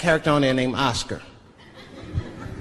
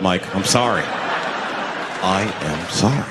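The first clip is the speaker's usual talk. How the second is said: lower, measured, apologetic bureaucrat; smooth government official; 6 semitones lower than usual. Pretend. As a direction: break bad news politely. Official briefing Michael in meeting.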